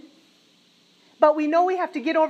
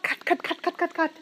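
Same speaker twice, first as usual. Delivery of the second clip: ordinary talk, nothing marked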